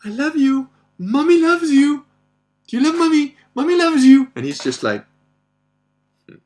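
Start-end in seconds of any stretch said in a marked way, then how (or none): 0.1-4.3 very high, loud mock-mother voice; shrieky falsetto mothering, hammed up; cloying mommy. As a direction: imitate a needy mom gushing at bedtime. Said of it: "She keeps saying, like, when he's going to bed".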